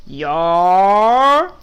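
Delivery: loud bleating yes like a flock of sheep; one big lazy sheep voice; sheep chorus. Acted. Sheep answer Duck together, loud bleating yes. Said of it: "they all says back in one big voice".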